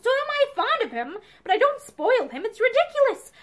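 high-pitched, irritated protest; testy. A narrator's reading. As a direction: argue testily with your wife. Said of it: "Matherson repeated testily".

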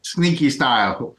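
slow, drawn-out catchphrase; slow knowing catchphrase echo. Retold or imitated. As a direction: savour the catchphrase. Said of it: "they say that he's got sneaky style".

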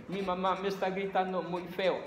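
put-on high whiny tattling kid; child as victim. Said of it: "La víctima". (niño quejándose con papá, voz aguda)

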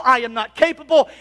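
ordinary delivery

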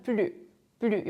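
"plus" without its final S; silent S. Shown as a demonstration. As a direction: say each "plus" as "plu". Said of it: "S가 소리가 나지 않는".

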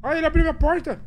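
high, loud frightened cry; panicking gamer. Real, not acted. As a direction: cry out as the ghost bursts in. Ghost opens her door.

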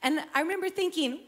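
ordinary delivery

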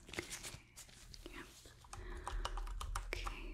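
very soft whispered observations; ASMR whisper. Acted. Examiner noting a human's sad face, hushed ASMR whisper. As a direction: murmur notes to yourself, barely audible.

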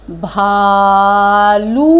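भालू slow and loud, ऊ held; long ऊ. Shown as a demonstration. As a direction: model भालू slowly. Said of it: "ऊ की मात्रा लगाने के बाद".

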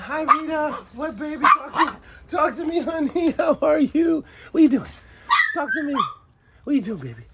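sing-song high voice for a pet; high pet-talk coo. Real, not acted. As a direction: coax the pet to answer, sweetly.

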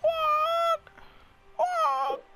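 very high, squeaky silly yelp; squealed mock cry. Gamer teasing teammates mid-match, squealed mock cry. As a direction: put on a tiny falsetto squeal.

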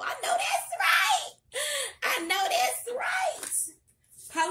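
high, loud laughing shout, stretched out; hype shout. Real, not acted. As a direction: cheer it like a hype woman. Hyping up the dragon card.

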